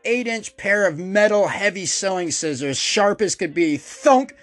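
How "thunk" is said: thunk sound effect. Retold or imitated. Scissors hitting leg.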